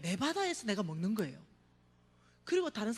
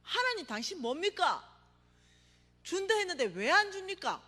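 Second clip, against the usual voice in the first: higher, louder reproach to God; retelling how he scolded God in anger; angry complaint; 8 semitones higher, 6 dB louder than usual. Retold or imitated. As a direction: demand an answer, indignant. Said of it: "내가 어느 날 화가 났어요".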